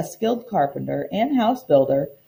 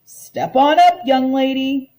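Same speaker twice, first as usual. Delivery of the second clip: calling out high and loud as the bus voice; a cheery welcome from a mysterious bus; friendly call; 6 semitones higher, 5 dB louder than usual. Acted. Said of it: "a voice called out".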